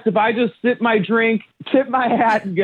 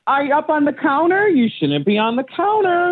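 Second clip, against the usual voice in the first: put-on baby talk voice; 8 semitones higher than usual. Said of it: "And she's like".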